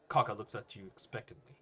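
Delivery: ordinary delivery, nothing marked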